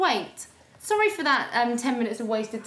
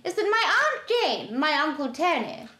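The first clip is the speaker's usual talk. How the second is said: high posh storytelling voice; put-on high posh voice; posh boy's voice; 5 semitones higher than usual. Shown as a demonstration. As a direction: tell it in a silly, horsey posh voice. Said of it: "my stupid posh boy's face on".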